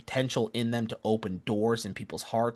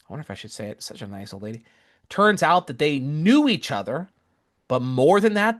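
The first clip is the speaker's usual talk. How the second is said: ordinary delivery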